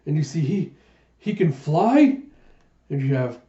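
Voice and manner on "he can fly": slow, raised, incredulous exclamation; astonished. Acted. An onlooker sees the pirate fly, astonished. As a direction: stretch it out higher and louder in amazement. Startled onlooker in retold manga.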